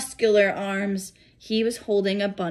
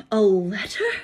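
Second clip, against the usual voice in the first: ordinary delivery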